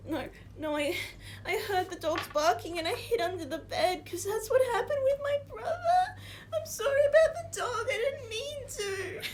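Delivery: put-on high, teary kid voice; crying child. Kid confesses about the dog, crying. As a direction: weep it out like a guilty little boy. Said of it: "he shakes his little head and he says".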